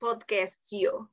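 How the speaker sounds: soft, deadpan Russian impression; put-on Russian, trailing off slowly; Russian accent. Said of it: "you have to do a Russian accent".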